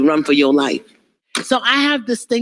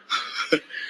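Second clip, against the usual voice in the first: soft breathy laugh; 11 dB quieter than usual. Real, not acted. Laugh after hallelujah.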